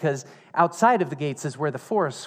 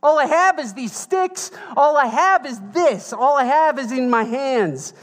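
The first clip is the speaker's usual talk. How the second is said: very high, loud, exasperated sass; sassy indignant widow; 12 semitones higher, 7 dB louder than usual. Retold or imitated. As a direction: fling it at him, high and loud. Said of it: "this is what that sounds like".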